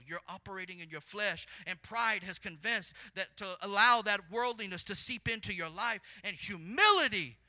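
loud pulpit warning; full-force preaching. Acted. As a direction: drive the warning home.

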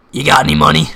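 low, loud gruff demand; homeless man voice. Retold or imitated. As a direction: growl the question low. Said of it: "he goes".

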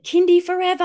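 put-on very high, loud kid cry; imitated child's spell. Child outsmarting mum's rule, high loud imitated shout. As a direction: proclaim it as a triumphant child. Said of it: "one of them the other day said".

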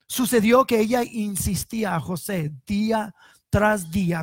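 measured Bible reading voice; scripture reading. Acted. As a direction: read the passage like a preacher at the pulpit. Reads Genesis passage aloud.

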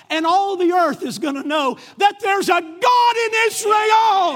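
high, very loud preaching roar, slowing on the final words; triumphant battle shout. Acted. David defying Goliath before battle, triumphant full-voiced shout. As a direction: build to a full-force declaration and hold it.

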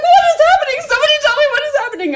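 very high, loud, frantic mock shriek; retold panicked scream. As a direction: beg for answers in acted panic, rushing at the end. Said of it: "screaming and crying".

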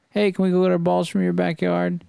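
high, polite calling-over voice; calling to neighbors. Retold or imitated. Father and child asking for their balls back, calling high. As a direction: ask lightly, pitched well up.

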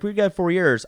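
high, loud eager reply; couple's proud answer. Retold or imitated. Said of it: "they're like".

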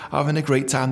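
ordinary talk, nothing marked

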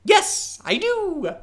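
put-on young goblin voice. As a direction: chirp it like a keen goblin kid. Balthazar answers the party.

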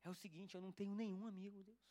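low, soft plea to God; tearful prayer retold. Retelling praying to God through tears, quiet confiding plea. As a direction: quietly open your heart, imitating a weeping child's prayer. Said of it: "comecei a abrir meu coração pra Deus".

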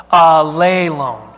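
ALELON loud and drawn out, ah-LAY-lone; wake-up pronunciation drill. Shown as a demonstration. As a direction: boom out "alelon" slowly to wake the room. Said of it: "helps the rest of you wake up".

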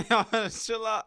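slow words through laughter; chuckling while telling them to chill; laughing. Real, not acted. Laughing at a crazy picture.

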